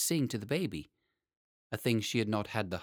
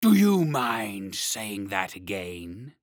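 slow, louder, polite dragon voice; 6 dB louder, much slower than usual. Acted. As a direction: ask it slowly and courteously, a big beast. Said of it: "quite politely".

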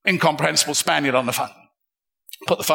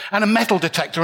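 normal talking voice

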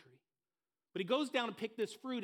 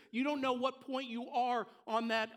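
usual speaking voice

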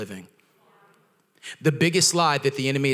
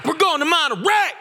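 much higher, loud joyful shout of "Monterey"; excited shout; 5 dB louder than usual. Retold or imitated. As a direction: yell it out with pure excitement. Shouting with joy.

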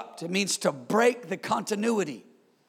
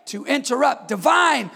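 higher, louder, hammering repetition; preaching at full force; 5 semitones higher, 8 dB louder than usual. Acted. Pastor preaches divine interruption to the church at full force. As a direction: drive each "To break" home.

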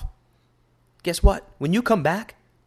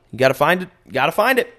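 usual speaking voice

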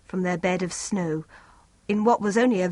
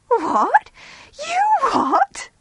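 much higher, loud disbelief; astonished girl; 6 dB louder, much slower than usual. A narrator's reading. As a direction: friend reacts to Mariette's news, astonished. Friend's amazed reaction.

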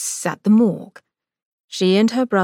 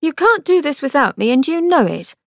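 higher, firm sister; Angelica, insistent; 6 semitones higher, 4 dB louder than usual. A narrator's reading. Sister insisting to brother, firm. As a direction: stand her ground.

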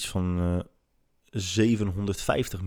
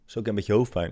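ordinary delivery

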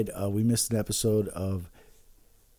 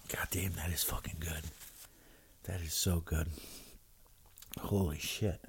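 whispered, much softer gasp of pleasure after the drink; hushed savoring; 7 semitones lower, 15 dB quieter than usual. Real, not acted. Host to listeners, savoring his shot in a much softer hushed voice. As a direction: breathe it out, stunned by how good it is.

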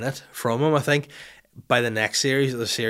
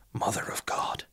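whispered, softer exclamation; hushed shock; 6 dB quieter than usual. Acted. Boss turning the page on a big reveal, stunned whisper. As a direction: breathe it out in mock stunned disbelief.

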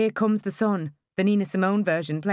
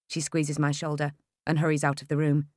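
ordinary delivery, nothing marked

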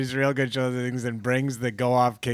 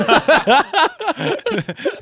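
weak little cry; 5 dB louder than usual. Pretend. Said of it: "we sound like feeble little".

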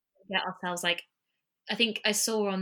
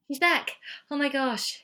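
higher, louder happy exclamation; really thrilled, pitched up; real delighted relief; 7 semitones higher, 4 dB louder than usual. Real, not acted. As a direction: greet a sudden return with excitement. Lost caller suddenly returns.

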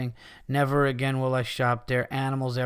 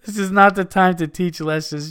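higher, louder, laugh held back; emphatic reread; 5 semitones higher, 5 dB louder than usual. Real, not acted. Host rereading a listener's rant after laughing, emphatic. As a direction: get the line out clearly after cracking up.

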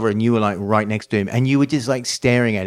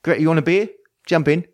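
a hint of a cheery London host, a bit higher; 6 semitones higher, faster than usual. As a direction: welcome them in like an eager old mate. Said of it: "they run out like [name]".

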